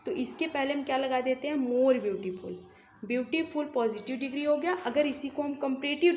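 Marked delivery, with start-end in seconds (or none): none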